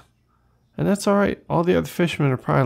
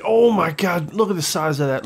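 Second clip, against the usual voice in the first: sudden burst of awe, higher at the start; startled amazement; 4 semitones higher than usual. Real, not acted. Player spots a huge bass mid-sentence, startled amazement. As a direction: blurt it out, amazed.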